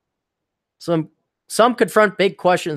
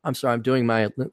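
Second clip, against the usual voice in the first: usual speaking voice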